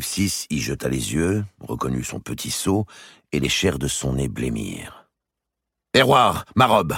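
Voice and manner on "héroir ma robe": loud, high shout for the servant. Acted. The king yelling at his chamberlain for his robe, loud. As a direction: bark the order across the room. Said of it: "cria-t-il".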